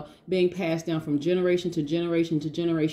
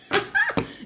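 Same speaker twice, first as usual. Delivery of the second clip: bursting laugh. Real, not acted. Laughs at own energy.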